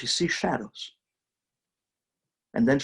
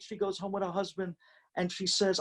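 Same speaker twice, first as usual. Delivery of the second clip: usual speaking voice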